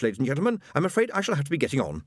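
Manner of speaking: ordinary delivery, nothing marked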